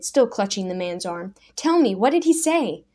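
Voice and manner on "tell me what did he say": urgent, eager. A narrator's reading. Daniel urgently presses the man.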